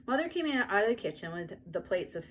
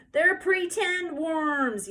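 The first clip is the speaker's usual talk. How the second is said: high, loud, slow exasperated yell; loud little-girl yell; 10 semitones higher, 7 dB louder, much slower than usual. A narrator's reading. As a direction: yell it at the crybaby. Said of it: "yelled Ramona".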